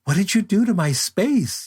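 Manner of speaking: put-on the French photographer upset at his assistant, mild French accent. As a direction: lightly imitate the French boss's accent. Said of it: "in his little French accent".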